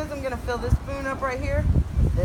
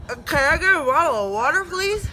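normal talking voice

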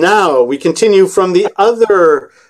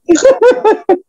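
snorting laughs. Acted. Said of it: "That is some laughing".

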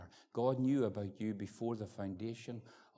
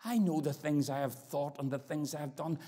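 builds to a loud, high pleading prayer; preaching at full force; 13 semitones higher, 11 dB louder than usual. Retold or imitated. Teenager praying to God, rising to loud preaching plea. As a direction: cry out for mercy.

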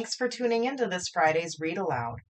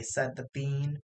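ordinary delivery

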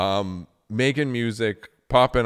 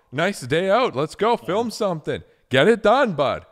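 put-on slipping into a Canadian accent on bud; a hint of a Canadian twang, higher; 7 semitones higher, 3 dB louder than usual. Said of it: "my Canadian came out there".

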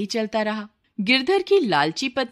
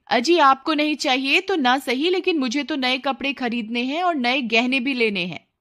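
higher, mildly whiny wife; greedy wife; 6 semitones higher than usual. Acted. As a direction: insist on what you want.

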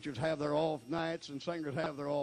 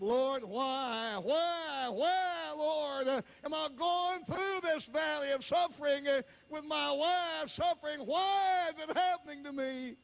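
anguished cry to God; 12 semitones higher, slower than usual. Retold or imitated. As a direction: cry out why to the Lord in anguish. Preacher retells crying out to God.

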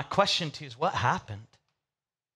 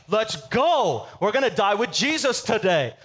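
hyped; 4 semitones higher than usual. Retold or imitated. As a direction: sell it like a pep rally. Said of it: "He's hyped".

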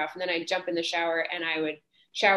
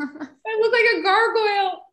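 high, loud tearful laughter through the words; laughing wail; 12 semitones higher, 5 dB louder than usual. Real, not acted. Actress recalling her bad skin, real helpless laughing wail. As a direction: squeal it, cracking up.

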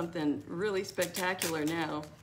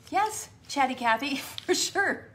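laughing; 6 semitones higher, 5 dB louder than usual. Real, not acted. Laughing agreement with chat.

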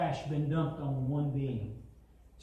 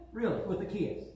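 hurt disbelief; 2 semitones higher than usual. Acted. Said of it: "can't you just hear Jesus saying".

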